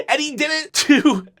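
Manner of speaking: ordinary talk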